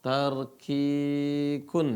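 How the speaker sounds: TARKIKUN drawn out very slowly; pengucapan istilah. Shown as a demonstration. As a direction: stretch the long vowel in Tarkikun. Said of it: "Apa itu tarkik?". (eja Tarkikun pelan dan panjang)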